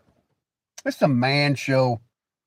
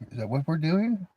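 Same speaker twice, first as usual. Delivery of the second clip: ordinary talk, nothing marked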